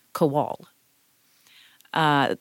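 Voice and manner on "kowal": Kowal with the weight on the second syllable, "ko-WAL"; stress on second syllable. Shown as a demonstration. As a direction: land "Kowal" on its last syllable. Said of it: "emphasis on my last name should be on the second syllable".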